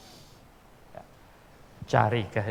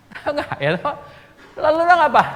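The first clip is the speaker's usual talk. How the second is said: loud preaching question; 11 dB louder, faster than usual. Real, not acted. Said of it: "asks the congregation, preaching".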